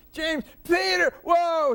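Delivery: excited shout. Retold or imitated. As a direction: shout his name like spotting an old friend. Old friend greets him loudly.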